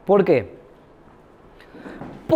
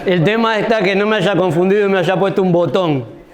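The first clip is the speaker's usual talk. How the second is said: talking around a candy in the mouth; sucking a hard candy. Real, not acted. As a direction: speak with a sweet tucked in the cheek. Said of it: "Tengo un caramelo en la boca". (hablando con un caramelo en la boca)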